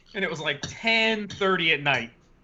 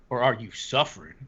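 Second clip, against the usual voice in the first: lower, lightly acted grave question; a hint of mock solemnity, pitched down; stern stranger; 6 semitones lower than usual. Homeless man questioning a customer, mildly stern. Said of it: "with this stern face".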